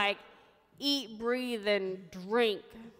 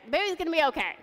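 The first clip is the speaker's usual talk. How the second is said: high-pitched mock yell; retold yelling; 6 semitones higher, 4 dB louder, much faster than usual. Staff yelling news of the baby to the mother, retold yell. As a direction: call it out like frantic hospital staff. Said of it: "and they're yelling".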